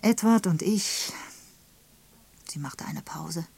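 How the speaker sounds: ordinary delivery, nothing marked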